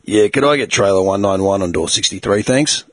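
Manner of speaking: low, deep dominating radio voice; put-on older-dude voice. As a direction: drop low to sound in charge. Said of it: "fuck around with my voice like that".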